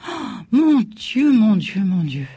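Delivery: high, loud panicked mother fading out; frightened mother's cry fading to a murmur; mother's alarm. Acted. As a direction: gasp it out in dread, dying away. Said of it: "ma mère pâlit".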